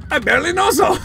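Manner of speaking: high yelling laugh; shouted laughing. Real, not acted. Player renaming a citizen and cracking up, shouted laughter. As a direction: lose it laughing loudly.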